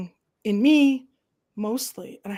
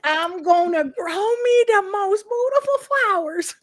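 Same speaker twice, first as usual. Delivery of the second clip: put-on much higher, playful Southern farm-girl voice; hammed-up Southern accent; 9 semitones higher than usual. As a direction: lift it up high and folksy. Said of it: "you say all the Southern things".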